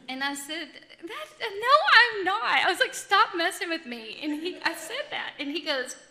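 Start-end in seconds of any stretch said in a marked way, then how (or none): 1.0-2.9 high, loud denial retold; disbelieving protest. 2.9-4.2 retold protest, high and loud; disbelieving protest.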